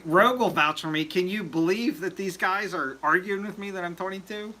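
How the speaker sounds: ordinary talk, nothing marked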